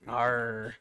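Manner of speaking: a short hammed-up pirate arr; pirate growl. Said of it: "I can't talk like a pirate".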